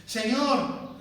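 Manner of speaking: usual speaking voice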